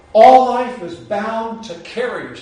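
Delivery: slow, loud, deliberate quotation; quoted line. Shown as a demonstration. Quoting Jung to the group, slow and weighty. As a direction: read each word out like a maxim.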